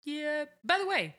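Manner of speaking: high, hesitant put-on voice; voicing Roger. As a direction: play Roger as timid and unsure. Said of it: "Roger's like".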